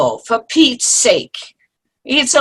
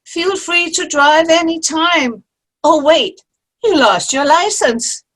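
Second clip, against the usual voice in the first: high, teasing sarcastic jab; 7 semitones higher, 4 dB louder than usual. Acted. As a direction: needle her with mock sweetness. Mocking jab at friend.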